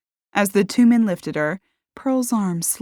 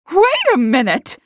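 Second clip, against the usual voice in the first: high, loud shout from behind; shouted challenge; 11 semitones higher, 7 dB louder than usual. A narrator's reading. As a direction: stop them with a shout. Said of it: "someone shouted behind them".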